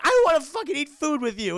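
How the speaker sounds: high, loud mock protest; angry refusal. Belle angrily refuses dinner.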